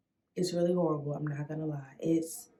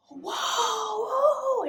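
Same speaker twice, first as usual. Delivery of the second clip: high crying whoa, reliving the needle; half-screamed whoa, much higher; retold scream. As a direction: cry out high as the pain hits. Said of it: "and I'm, like, screaming".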